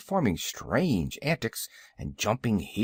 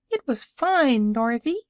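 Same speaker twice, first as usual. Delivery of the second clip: high-pitched little pig calling; piglet calling out; 11 semitones higher, 5 dB louder than usual. A narrator's reading. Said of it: "called one of the piglets".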